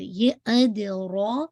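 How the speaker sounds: ادرا with a full-mouthed heavy ر; slow tajweed demo. Shown as a demonstration. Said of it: "یہ heavy, full of mouth کے ساتھ".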